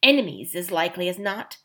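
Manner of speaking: usual speaking voice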